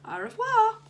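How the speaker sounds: very high, loud singsong French farewell; calling after. A narrator's reading. Teacher to departing pupil, calling a French goodbye after him. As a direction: call out goodbye in French, pitched right up. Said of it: "she called after him".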